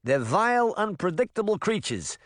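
a nervous cat veteran in broad New Jersey; rattled New Jersey accent; thick New Jersey accent. Acted. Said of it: "in a thick New Jersey accent".

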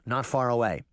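usual speaking voice

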